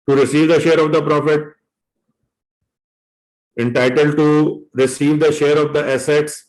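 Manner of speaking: very slow, word by word, for note-taking; slow dictation. Shown as a demonstration. Teacher dictating to students, slow and deliberate. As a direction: spell it out slowly so students can write it down.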